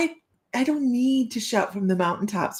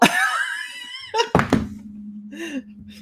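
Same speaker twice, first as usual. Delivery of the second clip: shrieking laugh. Real, not acted. To her viewers, a long high shriek of laughter.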